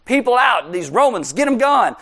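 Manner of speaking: rowdy crowd chanting for a revolution; worked-up crowd. Retold or imitated. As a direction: cheer like a fired-up mob hailing a king. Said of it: "the crowds are all worked up going".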